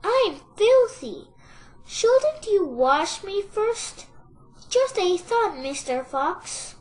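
high, singsong piglet voice; high, lilting little-pig plea; wheedling piglet. A narrator's reading. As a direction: suggest it innocently, pitched high. Piglet pleads slyly with fox.

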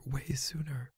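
soft breathy whisper, no voice. Acted. Boyfriend whispering an apology to his girlfriend, whispered. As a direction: breathe the words, barely voiced.

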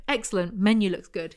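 normal talking voice

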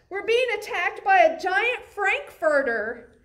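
panicked cry. Acted. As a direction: shout it while running away. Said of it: "cried the cats".

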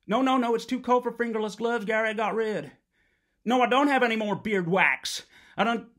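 loud, exasperated shouting at Gary; angry shouting. Acted. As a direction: blast it down the phone, fed up. Angry phone rant at Gary.

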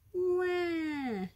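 put-on long, high baby cry; baby ghost wail. A narrator's reading. Baby ghost, alarmed at the noise, wailing. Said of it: "yelled baby ghost".

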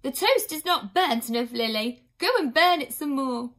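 a nurse furiously orders her sister. A narrator's reading. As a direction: scold your sister fiercely. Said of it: "She turned furiously to her sister".

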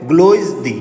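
গ্লোজদি said as "GLOYZ-dee"; pronunciation demo. Shown as a demonstration. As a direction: model গ্লোজদি for learners, stressed first syllable "gloyz", then "dee". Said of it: "উচ্চারণটা হবে".

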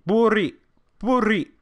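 Buri called out high and loud, falling at the end; called-out greeting. Pretend. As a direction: shout Buri to the chat on request. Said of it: "Please say Buri".

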